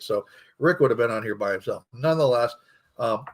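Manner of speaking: normal talking voice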